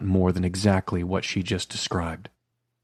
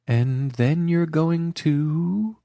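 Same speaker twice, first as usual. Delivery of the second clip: 1 teasing prompt; 9 semitones higher, much slower than usual. A narrator's reading. Carrie prompting him.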